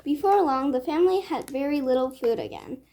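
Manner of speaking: ordinary delivery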